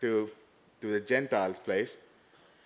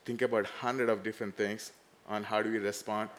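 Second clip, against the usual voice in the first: ordinary talk, nothing marked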